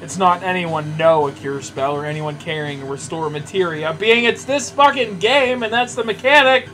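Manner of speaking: normal talking voice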